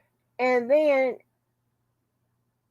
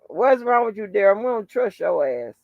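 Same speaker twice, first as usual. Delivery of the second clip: ordinary delivery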